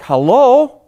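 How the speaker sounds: dry eye-roll hello; sarcastic hello. Acted. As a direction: drag it out like she's missing the obvious. Mocking the panicked TV mother.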